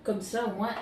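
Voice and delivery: ordinary delivery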